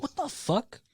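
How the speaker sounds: retelling his own shocked reaction; shocked disbelief. Retold or imitated. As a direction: blurt it out in stunned surprise. Shocked at baby's words.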